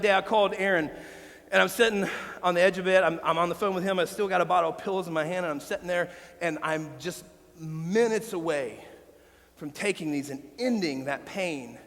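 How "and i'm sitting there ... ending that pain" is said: quiet heavy memory. Real, not acted. Pastor recalling the day he nearly died, low and heavy. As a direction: let the memory drag the voice down. Retelling his suicide attempt.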